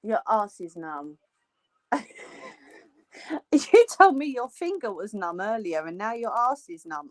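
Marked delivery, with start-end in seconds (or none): 1.9-2.5 breathy giggle. Real, not acted. Laughing at friend.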